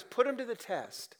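a light voice of God daring them; voicing God. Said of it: "See, God says".

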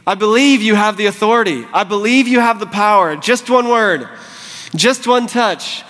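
high, ringing declaration of belief; confident faith. Acted. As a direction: declare it to the Lord with full conviction. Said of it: "when they come to him in faith".

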